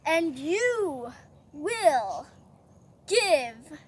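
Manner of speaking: slow, emphatic decree, word by word; royal command. Acted. King ordering Haman to honor Mordecai, slow decree. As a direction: hand down a drawn-out order.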